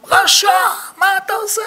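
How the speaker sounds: high, shrill-pitched outraged rebuke; mock angry shout. Rabbi in court plays up yelling at the rival. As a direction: yell at him like a furious rabbi. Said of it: "זה צועק עליו".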